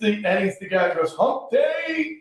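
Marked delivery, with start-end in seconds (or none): 1.1-2.2 imitated catchphrase. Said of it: "That's his voice".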